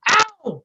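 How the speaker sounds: high, loud cartoon ow; goofy yelp of pain. Slapped sidekick crying out, goofy high ow.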